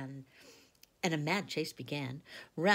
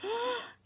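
shocked gasp; 4 dB louder than usual. Acted. Girls see the broken table.

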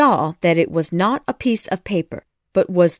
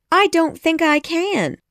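put-on much higher girl character voice; Wendy; 9 semitones higher than usual. A narrator's reading. Wendy answers Peter on the rock, high girl voice. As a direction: pitch it high for the girl's part.

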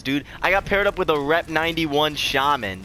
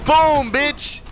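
triumphant shout; 10 semitones higher, much slower than usual. Real, not acted. Celebrating a hit.

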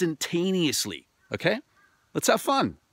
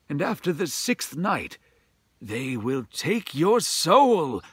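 grave priest warning; 2 semitones higher than usual. Acted. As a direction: warn him of the danger. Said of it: "said the priest".

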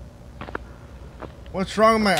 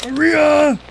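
loud, high call of a name; mock-scared shout, raised pitch; frightened call; 7 semitones higher, 6 dB louder than usual. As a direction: call out scared for a missing companion. Scared, calls out for Maria.

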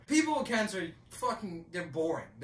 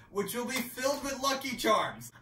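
normal talking voice

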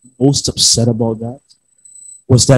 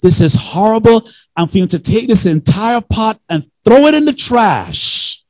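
wife upset; 10 semitones higher than usual. Retold or imitated. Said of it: "And she's".

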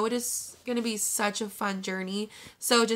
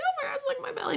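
squeal with a laugh; much faster than usual. Retold or imitated. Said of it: "You're like".

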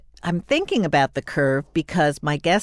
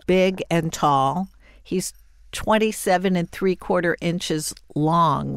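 ordinary delivery

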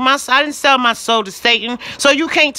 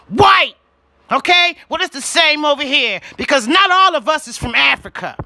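heated shouting; 4 semitones higher than usual. Real, not acted. Lecturing YouTube about races and tribes, shouting hotly. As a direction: drive every point home at full voice.

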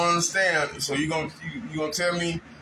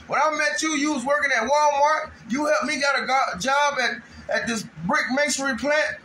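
heated rant; 7 semitones higher than usual. Real, not acted. Emotional rant about friend.

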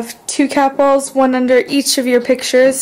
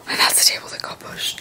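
hushed whispered aside; 8 dB quieter than usual. Real, not acted. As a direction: whisper it softly to your companion. Hushed aside during the investigation.

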